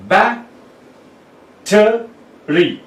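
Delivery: "battery" split as ba... te... ry with long gaps; syllable by syllable. Shown as a demonstration. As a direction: break "battery" into three separate syllables, slowly. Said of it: "Three syllables have come".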